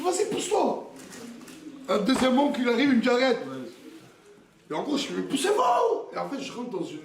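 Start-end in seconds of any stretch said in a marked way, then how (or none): 0.0-0.8 retold shove-off. Shoving past his uncle. 5.1-6.1 high, frantic yell; hysterical shout. Retold or imitated. Father pushing past his uncles, frantic high shout. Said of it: "j'étais en hystérie".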